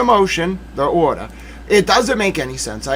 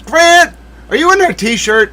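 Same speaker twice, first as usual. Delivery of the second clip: surprised call; 10 semitones higher, 3 dB louder than usual. Pretend. Calling self out again.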